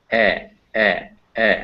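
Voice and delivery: "e" as in bed; short e vowel. Shown as a demonstration. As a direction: sound out "e" as a short eh, three separate times. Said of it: "as in red".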